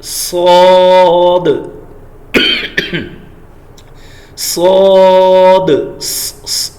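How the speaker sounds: صاد loud, very slow, with open mouth; heavy saad, mouth open. Shown as a demonstration. Teacher models heavy صاد for students. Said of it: "منہ کو کھولیں گے".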